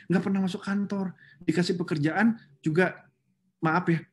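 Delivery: usual speaking voice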